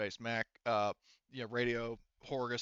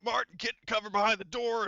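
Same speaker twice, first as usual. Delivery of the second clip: Horgus; 10 semitones higher, 6 dB louder than usual. Acted. Horgus sends the squad into ambush positions, drill sergeant shout. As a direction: drill sergeant barking orders. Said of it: "he's calling out to Rick".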